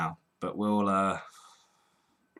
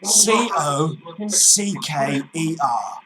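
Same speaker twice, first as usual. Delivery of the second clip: slow, higher, insistent letters; exasperated spelling; 4 semitones higher, 4 dB louder, much slower than usual. Pretend. Cockney caller respelling surname for the agent, exasperated. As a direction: hammer out each letter slowly.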